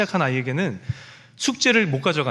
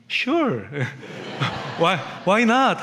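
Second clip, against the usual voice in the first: bright teacher voice; 2 semitones higher, much slower than usual. Retold or imitated. Father imitating a cheerful American teacher to his son, bright and laughing. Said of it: "그 되게 밝게 주실 거야".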